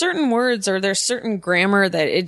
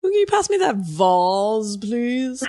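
slow, put-on voice, "vase" as vahz; mock-fancy request; 3 semitones higher, slower than usual. Guest mocking the vahz version, slow put-on voice. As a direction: overdo it, drawing out "vase" as vahz. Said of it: "Vase is ridiculous".

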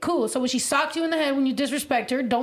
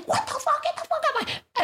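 much higher, mock enraged Marine yelling; mock angry yell. Retelling the Marine's reaction: much higher mock angry yell. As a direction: squeal it like the furious Marine. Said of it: "and he's like".

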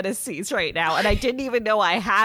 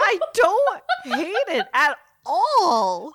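drawn-out, louder, higher swoon with laughter; gushing delight; 7 semitones higher, 5 dB louder, slower than usual. Real, not acted. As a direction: stretch the words out, laughing with delight. Gushing over the romance.

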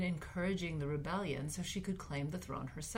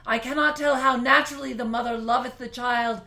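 big period-drama voice; 3 semitones higher, 11 dB louder than usual. Pretend. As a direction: declaim like a queen rallying her subjects. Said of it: "I'm gonna try to make a big voice like they do in period dramas".

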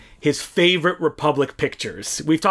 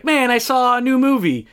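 higher-pitched mock boast; showing off; 7 semitones higher than usual. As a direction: act out a brag in a higher voice. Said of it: "I want to show off".

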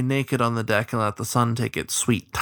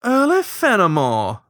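high, loud, drawn-out greeting; grinning crow; 8 semitones higher, 5 dB louder than usual. A narrator's reading. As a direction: greet an old pal slowly, high and loud. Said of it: "the crow grinned back".

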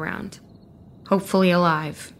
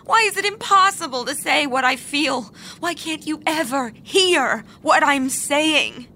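desperate shout; 7 semitones higher than usual. Acted. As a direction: cry out in frustration. Georgia cries out in quarrel.

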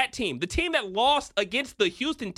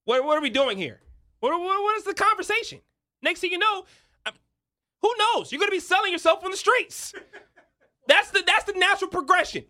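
high and loud, half laughing; laughing over-the-top rant; 10 semitones higher, 4 dB louder than usual. Real, not acted. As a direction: exaggerate the doom for laughs.

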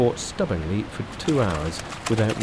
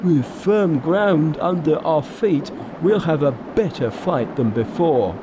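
Pigsy, challenging; 6 semitones higher, 3 dB louder than usual. A narrator's reading. Pig disciple luring the monster ashore, challenging.

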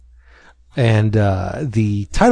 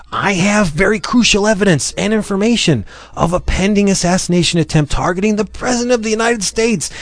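higher, fast hammed-up briefing; urgent agent; 8 semitones higher, faster than usual. Delta Force agent warning the columnist, high and rushed. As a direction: rattle off the warning like a movie spy. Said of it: "And he's like".

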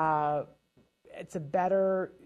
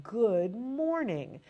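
slow, carefully articulated good morning; slow clear greeting; 3 semitones higher, much slower than usual. Shown as a demonstration. Parent greeting a child slowly and clearly. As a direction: spell each word out slowly. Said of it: "you're articulating to make sure that they can hear".

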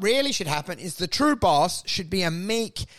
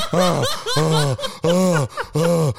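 loud panting oh-oh-oh gasps; mock hyperventilating; 5 semitones lower, 4 dB louder, much slower than usual. Genius hyperventilating under the desk, loud panting gasps. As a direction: pant in panic under the desk. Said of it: "you can hear him hyperventilating under a desk".

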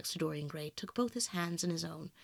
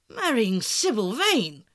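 loud, drawn-out exclamation of disbelief; amazed cry; 9 dB louder, much slower than usual. A narrator's reading. Lord Henry hears of his friend's marriage plan, amazed cry. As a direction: cry the words out slowly, astonished. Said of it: "looking at him in perplexed amazement".

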